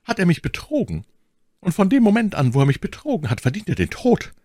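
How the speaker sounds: high, earnest lady's voice. A narrator's reading. My Lady condemns the man who betrayed her, in earnest. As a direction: pass judgement in a high, severe voice. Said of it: "sprach My Lady ernst".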